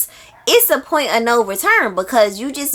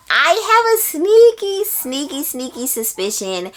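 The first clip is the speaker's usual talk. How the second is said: teasing, conspiratorial "sneaky"; mischievous hint; playful sneaky tease; 6 semitones higher than usual. Real, not acted. Playful repeated word.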